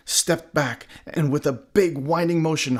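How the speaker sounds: usual speaking voice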